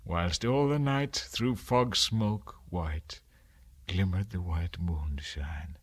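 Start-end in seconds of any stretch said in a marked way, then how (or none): 3.9-5.8 breathy hushed whisper. A narrator's reading. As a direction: let the moonlight line fall into a whisper. Hushed moonlit line in poem.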